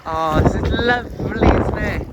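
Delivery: sarcastic. Real, not acted. Flooded chalet called lovely.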